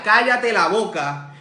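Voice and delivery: loud, slowed rebuke; scolding. Retold or imitated. Crowd in front rebukes the blind man, scolding. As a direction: order someone sternly to be silent. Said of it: "le reprendían para que se callase".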